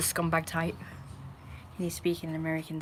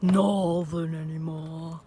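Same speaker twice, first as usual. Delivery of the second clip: put-on drawn-out, light northern English accent; a touch of mock northern English; much slower than usual. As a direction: lean slowly into a northern accent. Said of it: "I don't wanna speak northern".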